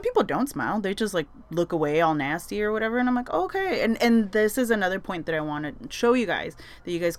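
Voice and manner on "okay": much higher, dismissive okay; sarcastic okay. Retold or imitated. Reacting to strangers who look away nastily. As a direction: shrug it off pointedly.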